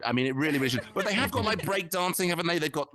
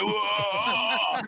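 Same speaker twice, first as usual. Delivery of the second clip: mock amazed whoa; 4 semitones higher than usual. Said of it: "It'd be like".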